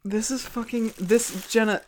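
normal talking voice

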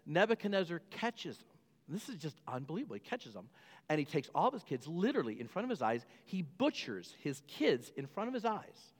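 usual speaking voice

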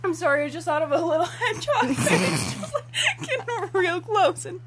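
talking through giggles; high, breaking into laughter; laughing. Real, not acted. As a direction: explain it while cracking up. Laughing while explaining.

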